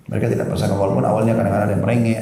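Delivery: ordinary talk, nothing marked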